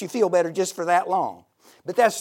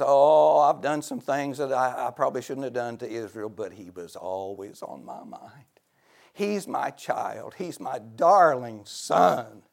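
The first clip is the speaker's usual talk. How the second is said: tender fatherly God; 6 semitones lower than usual. Acted. Preacher voicing God tenderly about his wayward son. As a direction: speak as a loving father.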